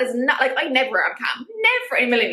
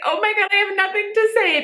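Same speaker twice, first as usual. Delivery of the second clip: high mock-panicked squeak; 9 semitones higher than usual. Imitating her own panicked reaction, high squeak. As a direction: play your own flustered panic. Panicked self-quote when asked to talk.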